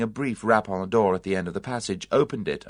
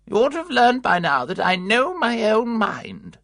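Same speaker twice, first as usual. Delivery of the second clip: put-on high, haughty elderly lady scolding; prim old aunt, loud and disdainful; indignant old aunt; 11 semitones higher, 5 dB louder than usual. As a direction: sniff at the visitors like an affronted old aunt. Aunt Ada voiced, stubborn.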